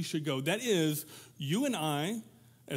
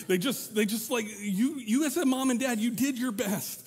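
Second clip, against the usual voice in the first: exasperated parent; 6 semitones higher, 3 dB louder than usual. Retold or imitated.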